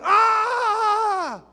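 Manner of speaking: long, high mock scream; imitated scream. Congregant interrupting the pastor's preaching, screaming out. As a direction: let out a loud drawn-out "aah" shriek. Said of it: "would stand up and just scream out".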